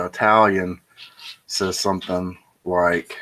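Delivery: usual speaking voice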